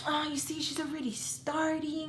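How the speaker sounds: high, mocking imitation of a complainer; whiny mock complaint; complaining boyfriend.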